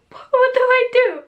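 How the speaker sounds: high, slow, drawn-out question; mock helpless panic. Woman mimicking her clueless partner by the bath, mock panic. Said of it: "he literally did not know what to do".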